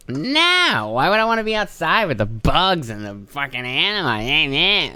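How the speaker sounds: high, slow put-on snooty voice; mocking the boss's disdain for outdoors; boss impression. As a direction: sneer it like a fussy boss. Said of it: "That's how he sounds".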